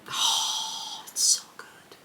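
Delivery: breathy, hushed "so good"; whisper. Real, not acted. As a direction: whisper it like a secret delight. Gushing over favourite scene.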